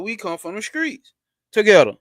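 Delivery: ordinary talk, nothing marked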